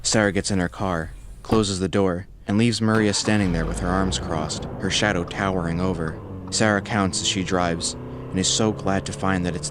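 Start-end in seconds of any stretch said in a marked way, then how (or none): none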